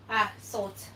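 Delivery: ordinary talk, nothing marked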